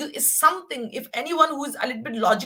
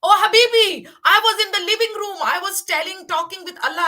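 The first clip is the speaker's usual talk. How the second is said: much higher and louder, fast; excited; 7 semitones higher, 6 dB louder than usual. Real, not acted. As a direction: burst out eagerly, rushing. Excited start of her story.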